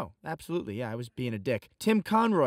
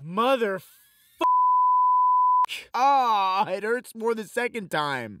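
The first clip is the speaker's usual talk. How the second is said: pained; 9 semitones higher, 7 dB louder than usual. Acted. Hurt by news of moving in.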